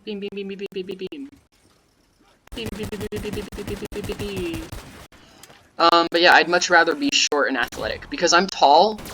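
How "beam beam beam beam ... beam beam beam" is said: high, soft chant of beams; soft, high repeated little noise; playful beeping. Pretend. Idly voicing noises while gaming.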